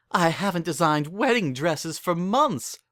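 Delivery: high, giddy excited gush; giddy squeal. Acted. Rarity greets the wedding news in a giddy squeal. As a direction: squeal with delight like a fashionista. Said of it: "said Rarity in a giddy squeal".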